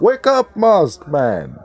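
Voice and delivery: high, loud "Wake up, Musk"; calling out. Real, not acted. Vlogger loudly calling on Musk to ban her account. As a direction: yell it to get Musk's attention.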